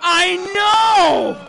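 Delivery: high, loud comic outrage; outraged. Acted. Comic telling audience about hero's death, loud outrage. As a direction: shout it, crushed and indignant.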